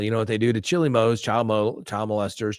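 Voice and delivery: usual speaking voice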